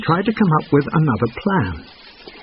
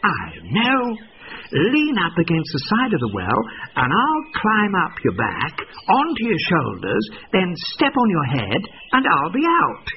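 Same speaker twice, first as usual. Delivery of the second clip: sly plan; 7 semitones higher than usual. A narrator's reading. Fox with his plan.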